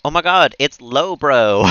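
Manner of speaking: loud, high, drawn-out delighted welcome; excited greeting. Real, not acted. Streamer spots Lowbro arriving in chat, excited greeting. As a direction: greet them with real excitement, stretching it out.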